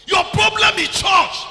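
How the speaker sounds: high, loud, slow pulpit shout. Real, not acted. Preacher shouting a rebuke at the congregation. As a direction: drive it home at full volume.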